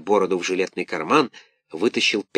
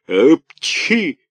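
high, loud sneeze burst; loud theatrical sneeze; 6 semitones higher, 6 dB louder than usual. A narrator's reading. Karabas sneezing while scolding, loud sneeze. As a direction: let the sneeze explode.

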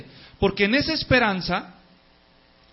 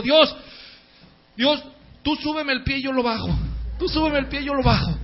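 pleading prayer; 4 semitones higher than usual. Retold or imitated. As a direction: cry out to God while running. Athlete praying while running. (atleta clama a Dios a gritos)

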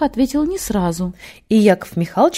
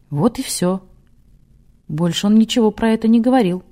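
plain reading voice

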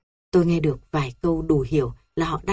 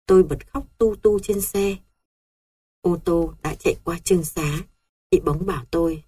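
ordinary delivery, nothing marked

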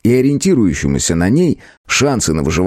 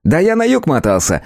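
lightly raised, cheeky elf voice; christmas elf; 9 semitones higher than usual. A narrator's reading. Elf character voice.

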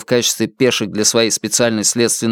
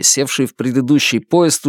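ordinary delivery, nothing marked

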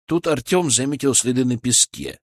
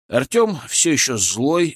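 usual speaking voice

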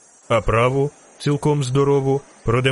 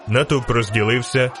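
plain reading voice

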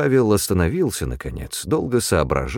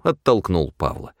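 ordinary delivery, nothing marked

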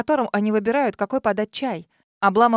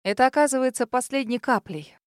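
plain reading voice

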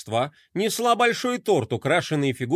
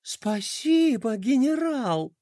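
touched gasp; 6 semitones higher, slower than usual. Acted. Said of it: "ахнула принцесса".

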